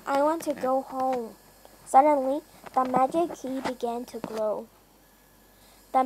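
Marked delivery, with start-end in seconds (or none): none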